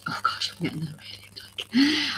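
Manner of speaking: a sudden whisper as emotion catches her; barely voiced, overcome for a moment; real whispered aside while choked up; breathless whisper. Real, not acted. Overcome mid-sentence on a live stream.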